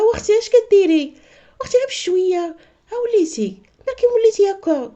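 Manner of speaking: high, loud, exasperated sister; exasperated teasing. Retold or imitated. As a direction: tease her loudly in a high voice. Teller scolding sister's fussing. (عتاب بصوت عالي ومرتفع)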